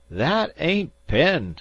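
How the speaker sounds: high, loud and slow, in alarm; frightened cry. A narrator's reading. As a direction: cry it out, scared stiff. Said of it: "cried Uncle Salters".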